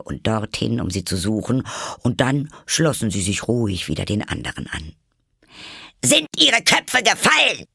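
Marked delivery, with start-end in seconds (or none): 6.0-7.6 very high, loud yell; screamed question. A narrator's reading. Queen checking on her soldiers, screaming. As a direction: scream it at them. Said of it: "schrie die Königin sie an".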